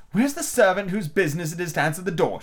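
high, indignant demand; looking for someone to blame; Alice angrily demanding the servant; angry. A narrator's reading. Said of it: "she began angrily".

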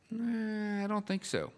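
high, soft doubtful "eh"; skeptical. Acted. Preacher answering an imagined objection, skeptical.

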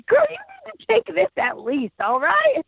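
high, bright imitation of a chatty woman from a car; roadside stranger. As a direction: play the nosy friendly stranger calling from her car. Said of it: "shoves it out the window and is like".

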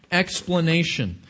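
ordinary delivery

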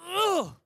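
short high straining grunt; one hard heave as the lid gives; effort grunt. Retold or imitated. Pastor acting out the jar lid finally opening with a grunt.

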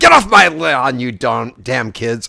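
a hint of a gruff grumpy old man; lightly voiced Clint Eastwood growl; Clint Eastwood impression. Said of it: "trying to do Clint Eastwood in Gran Torino".

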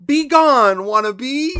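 very high, loud hammed-up quote; mocking movie line. Mocking a movie line to his cohost, shrill and hammed-up. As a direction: squeal it like a cartoon villainess. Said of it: "never heard anyone say".

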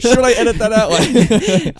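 high, loud mock worried voice; mock panicked editor. Host imagining editing the episode, high loud mock worry. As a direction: squeal it like a fretting editor. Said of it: "I'm like".

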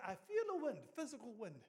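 put-on stunned old fella shouting his wonder; astonished old man. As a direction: cry it out like you can't believe it. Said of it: "he said".

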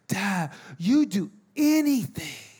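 put-on slow, childlike awe; little boy. Dad voicing his young son amazed, slow childlike awe. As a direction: say it slowly with a small kid's wonder. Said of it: "and he goes".

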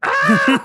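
mock scream, high and loud; imitated scream. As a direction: yelp like someone hit off-screen. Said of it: "you hear somebody scream".

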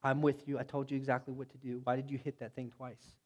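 quiet and measured, like gently calling him out; God talking privately with Moses, low and soft; calm voice of God. Acted. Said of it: "God has a little powwow with Moses and said".

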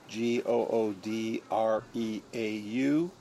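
slow letters, one at a time; spelling it out. Shown as a demonstration. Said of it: "how do you spell it".

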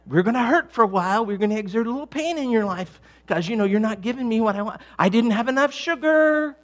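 high, loud complaining yell, acted out; body yelling. Body yelling at its owner over missed caffeine, mock outraged yell. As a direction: holler like a demanding body throwing a mock fit. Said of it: "your body's kind of yelling at you".

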